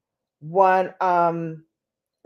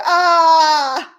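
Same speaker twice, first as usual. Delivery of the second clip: high, loud drawn-out "aah"; dramatic wail; 8 semitones higher, 8 dB louder than usual. Real, not acted. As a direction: let out a long high cry. Dramatic wail at missing the live.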